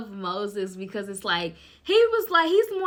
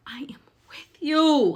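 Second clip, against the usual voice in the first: hushed divine whisper; whispered God; 4 semitones higher, 6 dB louder, slower than usual. Acted. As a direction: breathe it like a secret reassurance. God's reply voiced in story.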